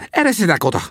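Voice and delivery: high-pitched character voice; excited, high-voiced order; insistent Sam. A narrator's reading. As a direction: demand room in a high voice. Sam pushes friends aside.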